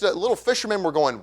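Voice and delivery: normal talking voice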